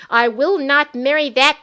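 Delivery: high, loud, raging scream; furious shout. Acted. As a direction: scream it in towering rage. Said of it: "Thessalina shouted".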